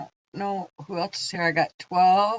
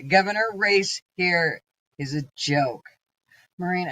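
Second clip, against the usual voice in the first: ordinary talk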